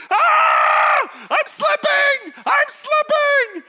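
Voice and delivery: very high, loud, drawn-out mock shriek; panicked child. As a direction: scream slowly like a kid losing his grip. Son hanging, losing his grip.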